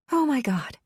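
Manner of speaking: breathy voice. Acted. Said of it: "she said in this breathy voice".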